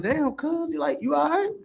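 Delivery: high, teasing "you all right?"; amused disbelief. Retold or imitated. The narrator teasing his terrified cousin with high mock concern. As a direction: tease a jumpy friend in a high incredulous voice. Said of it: "I'm like".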